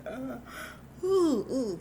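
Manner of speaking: high-pitched pretend waking sounds; little high oohs and ahs; waking bear noises. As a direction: imitate a bear rubbing sleep away. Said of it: "he's like".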